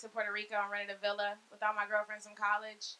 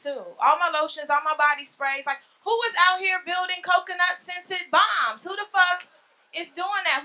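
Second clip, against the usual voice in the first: loud, higher, building comic rant; 4 semitones higher, 7 dB louder than usual. Real, not acted. Comic rants to audience about lost lotions, loud and high. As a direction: rail at the injustice of it.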